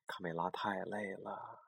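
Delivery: soft whispered narration; whispered bedtime narration. A narrator's reading. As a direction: whisper it like a sleepy secret. Bedtime story, tired chick.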